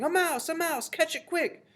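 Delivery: high, urgent cries; shouting. A narrator's reading. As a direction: shout urgently to the whole kitchen. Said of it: "they were shouting".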